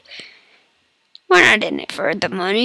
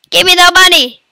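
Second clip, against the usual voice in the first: robber demand; 6 semitones higher, 4 dB louder, faster than usual. Acted. Robbing the bank.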